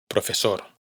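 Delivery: ordinary delivery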